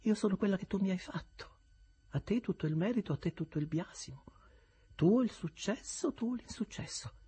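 lightly voiced cool young woman; calm, detached reply; Estella, cool. Estella answers Havisham calmly, lightly voiced.

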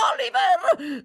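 very high, loud crying call. Acted. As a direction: call the boy desperately. (grido acutissimo)

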